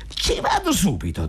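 annuncio animato in tono molto acuto; leggera euforia. A narrator's reading. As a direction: dillo eccitato, con voce alta che scende alla fine. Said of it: "rianimato e leggermente euforico". (proposito euforico e acuto)

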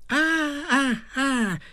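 brevi ah acuti e distaccati; un funzionario che si riscuote; risatina del commendatore. Acted. Il piccolo uomo si sveglia. (ah ah ah acuti e lenti)

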